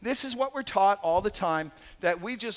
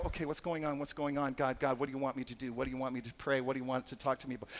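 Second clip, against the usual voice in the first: soft, quick inner voice asking God what to do; alert prayer; 6 semitones lower, 6 dB quieter, faster than usual. Retold or imitated. Believer quietly asks God what he wants, softly and quickly. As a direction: murmur it eagerly under your breath. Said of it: "Start to go".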